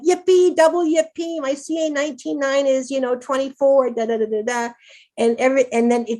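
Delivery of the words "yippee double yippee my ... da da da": high, bright, gleeful cheer, much higher; excited yippee. Retold or imitated. Patient celebrating a good tumour-marker result, gleeful high cheer. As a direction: squeal with glee over a good number. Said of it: "And I'd be like".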